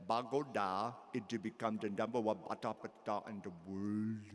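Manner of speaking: a quick Austrian-accent impression, mumbled; thick Arnold accent. Speaker retells Arnold's answer to the audience, mock Austrian accent. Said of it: "in that thick accent".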